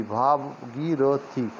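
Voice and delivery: "Bhagirathi" very slow, as "bhaa-gii-ro-thi"; syllable by syllable. Shown as a demonstration. Teacher sounds out Bhagirathi for learners, syllable by syllable. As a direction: stretch and separate each syllable of "Bhagirathi".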